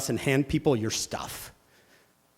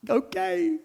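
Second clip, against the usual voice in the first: put-on much higher kid voice; unimpressed kid. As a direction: shrug it off.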